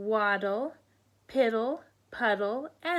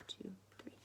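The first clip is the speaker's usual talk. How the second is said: whisper; 20 dB quieter than usual. Real, not acted. Whispered counting.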